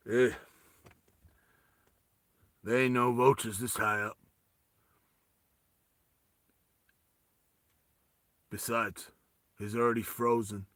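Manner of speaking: low, unmoved tough-guy voice; Sylvester Stallone. Acted. Leader brushes off Vas's protest, low Stallone impression. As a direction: answer low, with long pauses. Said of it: "Sylvester Stallone looks at him and goes".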